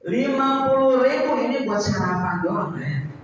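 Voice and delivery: high, loud scolding rant; wife scolding husband for bringing only fifty thousand; nagging wife. Acted. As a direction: berate him over the money. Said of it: "apalagi suara keras".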